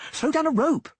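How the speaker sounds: very high, loud shouted plea; bellowed demand. Acted. Dandelion demands a rope from the Reavers, loud high bellow. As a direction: bellow at the others for help. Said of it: "Dandelion bellowed".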